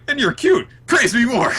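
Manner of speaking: very high, loud playful gushing; hammed-up eager delight. Host acts out Finral flirting and Noelle basking, mock gushing. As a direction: gush it in a big cartoon voice. Acting compliment and same reaction.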